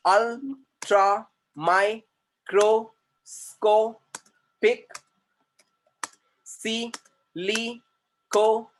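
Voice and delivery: "New, मो, नो, ultra, microscopic, silico, volcano, कोनिओसिस" high and loud, one syllable at a time; "new, मो, नो, ultra, microscopic, silico, volcano, कोनिओसिस" drilled in slow, detached syllables with long gaps. Shown as a demonstration. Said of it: "syllabus लगाने का".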